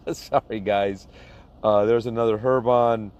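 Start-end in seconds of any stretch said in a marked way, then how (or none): 0.1-1.1 laughing apology. Real, not acted. Apologizing after skipping a name.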